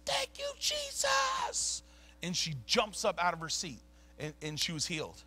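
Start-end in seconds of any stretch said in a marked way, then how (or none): 0.1-1.8 high, drawn-out mock scream; imitated scream. Healed woman on the bus crying thanks, imitated scream. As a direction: squeal it out slowly, very high, held back in volume. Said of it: "and she just screams".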